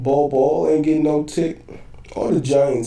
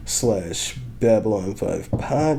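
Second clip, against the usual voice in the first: ordinary talk, nothing marked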